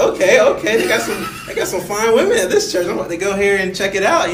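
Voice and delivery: gleeful, eager; excited. Retold or imitated. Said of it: "I was like".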